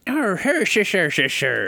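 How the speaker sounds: high, loud attempt at Irish; bad Irish accent. Asked for an Irish voice on shamrocks, botched Irish accent. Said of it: "Do you have a good Irish one".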